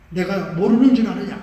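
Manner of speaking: loud thundering rebuke from the Spirit; rebuking shout. Retold or imitated. Preacher voicing the Spirit rebuking the deacon, loud rebuke. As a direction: boom it out like a stern rebuke. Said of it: "막 성령이 이러는 거예요".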